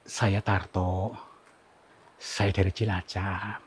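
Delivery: slow and soft, very respectful; slow polite introduction. Real, not acted. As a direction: introduce yourself carefully, slowly. Introducing himself to a spirit. (memperkenalkan diri pelan dan sopan)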